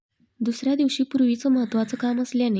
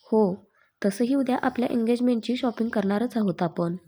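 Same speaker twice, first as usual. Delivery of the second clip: ordinary delivery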